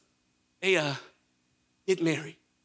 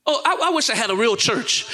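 ordinary talk, nothing marked

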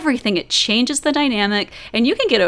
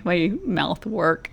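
usual speaking voice

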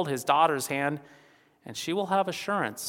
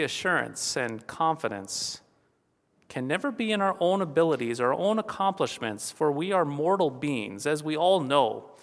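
ordinary talk, nothing marked

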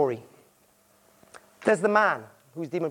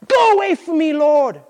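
high, loud cry of unworthiness; anguished cry; 9 semitones higher, 9 dB louder than usual. Acted. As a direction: cry it out, overwhelmed by awe. Peter crying out in awe.

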